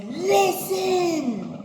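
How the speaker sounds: high, loud single word; God's loud command. Retold or imitated. Preacher voicing God telling Cain to listen, loud. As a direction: call it out like God demanding attention. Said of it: "Some translations say".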